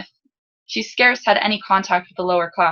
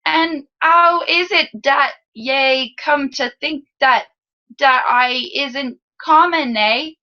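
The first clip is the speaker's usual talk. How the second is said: slow, high, laboured attempt at a lower-class accent; overdone lower-class Salzen accent, slow and higher; imitated lower-class accent; 7 semitones higher, 3 dB louder, slower than usual. A narrator's reading. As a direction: badly fake a street accent, drawing it out. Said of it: "Trying to talk like me".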